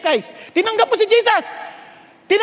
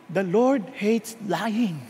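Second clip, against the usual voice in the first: solemn divine voice; 8 semitones lower, 7 dB quieter, much slower than usual. Acted. Voice of the Lord in prayer.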